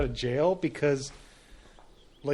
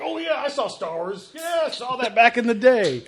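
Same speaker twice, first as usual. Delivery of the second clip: pirate voice; 7 semitones higher, 3 dB louder than usual. Retold or imitated. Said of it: "he sounded like a pirate".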